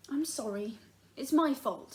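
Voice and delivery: ordinary delivery, nothing marked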